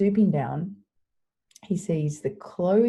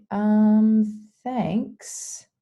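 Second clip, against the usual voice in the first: high, drawn-out awkward thanks; put-upon, loud 'um', pitched up; 5 semitones higher, 6 dB louder than usual. Retold or imitated. Mary left behind by the disciples, awkward. Retelling Mary's reaction as disciples leave.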